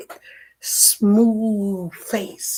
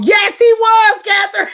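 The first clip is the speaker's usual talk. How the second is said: excited shout; 9 semitones higher, 6 dB louder than usual. Real, not acted. Answering a viewer about her late father, in a loud, high, laughing shout. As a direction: belt out the yes with a laugh.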